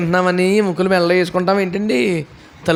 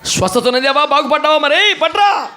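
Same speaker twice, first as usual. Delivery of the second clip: mocking greedy demand; 8 semitones higher than usual.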